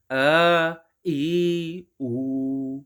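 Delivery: आ ई उ stretched very long, each vowel held; drawn-out vowels. Shown as a demonstration. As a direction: pull out आ, ई, उ slowly and fully. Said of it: "खींच के पढ़ेंगे". (आ ई उ को खूब खींच कर बोलना)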